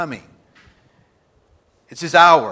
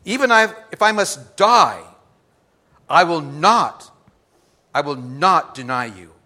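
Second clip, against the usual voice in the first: louder and higher, swearing loyalty; a loud proud promise, raised pitch; Peter's loud vow; 4 semitones higher, 9 dB louder than usual. Acted. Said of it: "He says to him".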